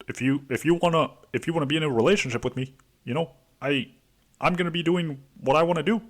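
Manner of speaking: mock tough-guy actor voice, rushed and high; Adam Driver impression. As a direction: do a quick hammed-up Adam Driver. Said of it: "it's so fucking fun to do Adam Driver impersonation".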